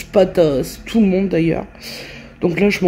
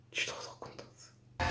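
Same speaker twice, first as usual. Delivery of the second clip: very soft, voiceless whisper; happy whisper; 24 dB quieter than usual. Real, not acted. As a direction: whisper your delight, barely audible. Confie sa joie en chuchotant. (chuchotement ravi, à peine audible)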